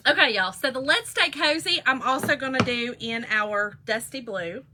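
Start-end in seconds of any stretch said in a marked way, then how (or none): none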